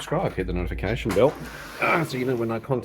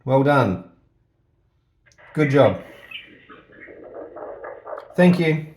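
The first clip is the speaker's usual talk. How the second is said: calling into intercom; 4 semitones higher, 5 dB louder, slower than usual. Real, not acted. Testing the intercom door.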